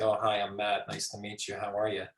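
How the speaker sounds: low, soft, toneless introduction; expressionless greeting. Shown as a demonstration. Lecturer showing a greeting without nonverbal communication, expressionless. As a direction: say hello with no warmth or expression, quietly and low. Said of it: "you're missing this whole nonverbal communication".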